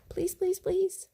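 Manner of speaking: put-on high little kid begging; begging children. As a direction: plead in a tiny high voice. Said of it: "they begged her".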